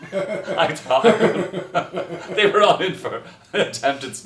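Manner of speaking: laughing through the words; can't stop laughing telling it. Real, not acted. Telling the prison story, laughing hard.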